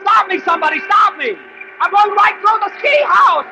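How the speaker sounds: high loud frantic cries for help; panicked yelling. Acted. Jack hurtling toward the ski house, frantic high yells. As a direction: scream for someone to stop you.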